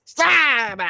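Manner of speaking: high, loud "Stop!"; sudden shout. Real, not acted. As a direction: shout it to cut things off. Speaker cuts in on the story.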